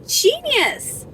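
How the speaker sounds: high, drawn-out mock awe; retold amazement. Mom reacting to daughter's phone understanding Russian, high amazed voice. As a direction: marvel at it in a high, stretched voice.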